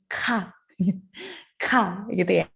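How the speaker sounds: "ka" with a strong burst of air; spitting aspirated ka. Shown as a demonstration. Teacher jokingly showing an explosive Korean "ka". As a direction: push hard air out on "ka". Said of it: "kalau belum muncrat, belum afdol".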